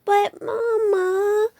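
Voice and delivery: put-on high, slow, crying little-girl whine; whining Sister. A narrator's reading. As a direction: beg Mama in a high whiny cub voice. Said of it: "whined Sister".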